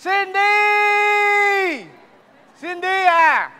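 high, drawn-out shout; calling out. Acted. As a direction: holler the name long and high to summon someone. Gọi lớn tìm Cindy. (gọi to, cao giọng kéo dài)

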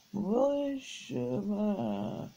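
very slow, drowsy mumble from bed; barely awake, dragging the words; half asleep. A narrator's reading. Phyllis woken by her sister pulling her hair, half asleep and slow. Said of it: "still almost wholly asleep".

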